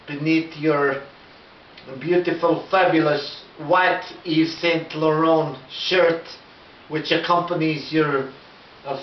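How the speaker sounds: slow, lightly put-on French accent; a French caller taking his time. Yves Saint Laurent caller asks for the YouTube star, in a slow French accent. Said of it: "it's got the accent".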